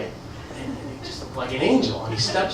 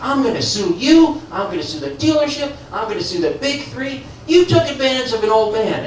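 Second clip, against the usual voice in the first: mock-furious old man, higher and louder; angry old man; 8 semitones higher, 3 dB louder than usual. Old customer threatening to sue the salesman, mock-furious. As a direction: storm in threatening to sue everyone. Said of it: "He storms in the front doors".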